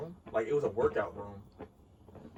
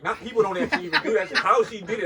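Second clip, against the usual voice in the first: laughing; 9 semitones higher, 12 dB louder than usual. Real, not acted. Amazed at repacked computer.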